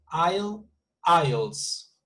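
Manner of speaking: "isle" rising, then "isles" falling with a final S; singular-plural demo. Shown as a demonstration. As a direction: contrast "isle" with "isles", voicing the added S. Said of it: "it would be like this".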